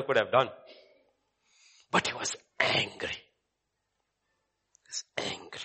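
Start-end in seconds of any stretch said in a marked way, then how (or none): 1.9-3.2 hushed, breathy whisper of anger. Acted. Preacher whispers to the congregation about the brother's anger. 4.8-5.6 hushed, breathy whisper of anger. Acted. Preacher whispers to the congregation about the brother's anger.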